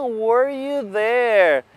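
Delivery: were you there stretched slowly; slow model question. Shown as a demonstration. Teacher slowly modelling were you there for students.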